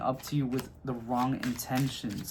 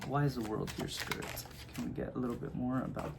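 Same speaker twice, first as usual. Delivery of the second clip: whispering; 3 semitones lower, 11 dB quieter than usual. Real, not acted. Whispering a question to the spirit.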